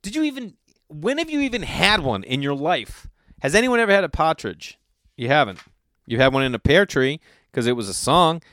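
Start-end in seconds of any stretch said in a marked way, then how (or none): none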